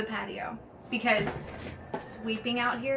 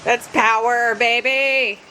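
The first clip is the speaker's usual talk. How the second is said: much louder, then much higher squeals; loud shouted joy, laughing at the end; shouting over noise; 5 semitones higher, 12 dB louder, slower than usual. Real, not acted. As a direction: yell over the roaring leaf blower. Shouting as blower roars.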